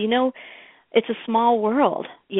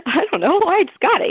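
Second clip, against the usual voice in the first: laughing shrug; 10 semitones higher, 4 dB louder than usual. Retold or imitated. Her laughing answer to partner.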